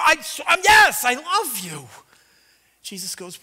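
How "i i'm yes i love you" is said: loud, higher frustrated yell, drawn out; Peter shouting. Retold or imitated. Peter snaps at Jesus, asked a third time, with a loud frustrated yell. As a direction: burst out in exasperation. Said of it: "Peter gets mad".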